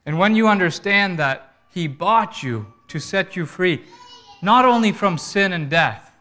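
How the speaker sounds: loud, high, forceful sermon delivery; ringing, emphatic pulpit proclamation; impassioned preaching. Real, not acted. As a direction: preach it with full conviction.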